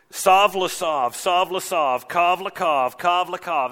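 repetitive singsong in even beats; mock baby talk. Leaders mocking the prophet in Hebrew, baby-talk singsong. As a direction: chant the syllables like nonsense. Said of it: "they try to speak baby talk".

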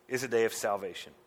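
ordinary talk, nothing marked

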